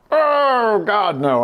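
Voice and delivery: high, loud drawn-out wail dropping down; hammed-up horrified refusal; mock dread. Refusing friends at the roller coaster, mock dread.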